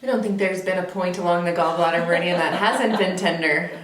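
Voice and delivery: speaking through a laugh; amused, chuckling as she says it. Real, not acted. Laughing at the endless tender points.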